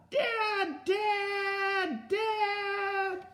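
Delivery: very high-pitched drawn cry for Dad; yelling for dad. Acted. Scared child to dad during thunderstorm, high yelling. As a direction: call out like a frightened child in a thunderstorm. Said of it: "I yelled for my dad".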